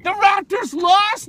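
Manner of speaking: high, loud, drawn-out disbelief; shocked outcry. Real, not acted. Fan learns the Raptors lost, high shocked outcry. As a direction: yell it as if stunned by the score. Hears bad news about his team.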